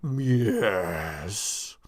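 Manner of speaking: low, distant servant's reply; deep tall man. Acted. The tall man replies to the medium in a low, distant voice. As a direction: answer in a low, detached voice.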